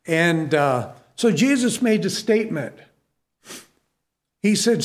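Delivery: ordinary talk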